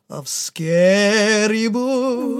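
spooky. A narrator's reading. Spooky storytelling emphasis.

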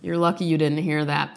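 normal talking voice

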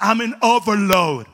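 slow, loud English quote; gringo English. Retold or imitated. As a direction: quote the American again, slowly. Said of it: "el tipo me dijo literalmente".

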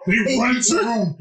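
ordinary talk

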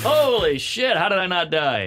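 high, loud astonished gasp of words; shocked. Retold or imitated. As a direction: wake up stunned on the highway. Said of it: "And I was like".

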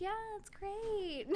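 high, soft, drawn-out fake approval; slow, polite pretend enthusiasm; insincere praise. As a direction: stretch it out as if humoring someone. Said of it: "would have genuinely been like".

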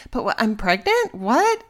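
can't believe what she just heard; shocked disbelief. Retold or imitated. Inner shock at hearing pregnant.

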